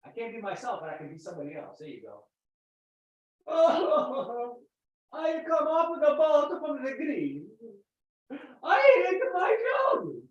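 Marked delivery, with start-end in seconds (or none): 3.5-10.2 put-on high, loud Greek-accented character. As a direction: wail in a hammed-up Greek accent. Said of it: "Now cut out the Greek accent".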